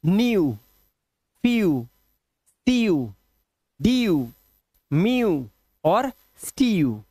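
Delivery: न्यू, फ्यू, डीयू each ending in a clear "yoo" glide; EW read as "ee-yoo". Shown as a demonstration. Teacher reading examples to learners, "yoo" ending on न्यू and फ्यू. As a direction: give न्यू, म्यू, स्टीयू an "ee-yoo" ending, word by word. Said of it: "EW को ईयू से पढ़ना है".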